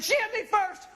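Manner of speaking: very high, indignant tattling; mock childish protest.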